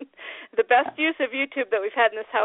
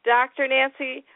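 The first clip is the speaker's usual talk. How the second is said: ordinary talk, nothing marked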